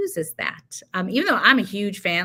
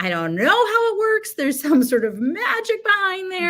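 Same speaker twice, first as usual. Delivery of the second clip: mock mystified wonder; 11 semitones higher, 5 dB louder than usual. Marvelling at how the method works.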